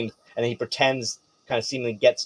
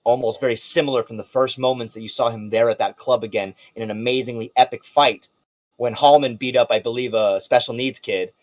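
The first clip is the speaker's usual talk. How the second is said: ordinary talk, nothing marked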